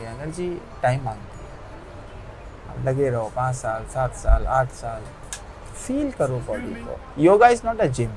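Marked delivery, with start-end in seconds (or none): 5.7-8.1 slow yoga guidance. Shown as a demonstration. Guiding the feel of yoga.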